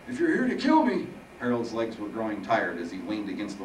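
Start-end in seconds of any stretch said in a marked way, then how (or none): 0.1-1.1 put-on high, loud old-man voice; shaken old Harold. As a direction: play Harold high and strained, facing his killer.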